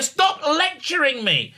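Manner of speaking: high and slow, without shouting; exasperated demand. Retold or imitated. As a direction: spell out the annoyance, drawn out. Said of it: "That's actually a".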